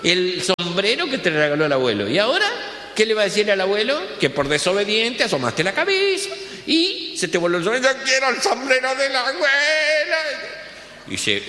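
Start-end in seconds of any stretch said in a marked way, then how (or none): 0.0-7.9 scolding parent. Acted. Parent scolding child, voiced. 7.9-10.2 put-on high whining child voice; whiny child. Spoiled kid whining to his father for the hat.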